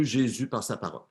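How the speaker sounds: ordinary talk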